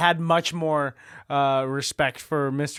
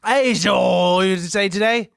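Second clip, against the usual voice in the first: over-the-top British, louder; heavy British accent; 3 semitones higher, 6 dB louder than usual. Acted. Cashier actor overdoing the British accent. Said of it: "too much British".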